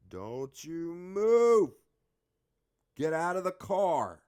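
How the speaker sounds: high, loud, drawn-out command; retold police shout. Officer coming up with a gun, shouted orders. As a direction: shout it like an armed cop's order.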